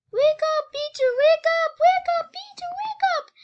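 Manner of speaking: very high, loud storytelling scream. A narrator's reading. Scared girl waking her brother Peter, screaming. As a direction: scream to rouse a sleeper in alarm. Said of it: "Why are you screaming like this".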